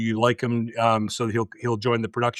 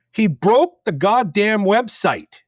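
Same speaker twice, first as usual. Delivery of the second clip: slow, loud, high-pitched mock outrage; retold outrage, played for laughs; comic exasperation; 8 semitones higher, 5 dB louder, slower than usual. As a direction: hammer out each word in disbelief. Exasperated punchline about trainee's blunder.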